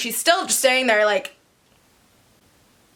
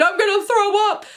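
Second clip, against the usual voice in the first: mock scream; 10 semitones higher than usual. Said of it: "She literally screamed".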